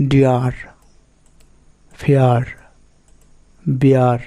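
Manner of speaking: "ডিয়ার, ফেয়ার, বিয়ার" with a glide into "ar"; ear said as "i-ar". Shown as a demonstration. Teacher giving examples of the ear rule, "ডিয়ার, ফেয়ার, বিয়ার" with a glide into "ar". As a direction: say "ডিয়ার, ফেয়ার, বিয়ার" one by one, gliding from the vowel to "ar". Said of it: "উচ্চারণ ই আর এর মতো হবে".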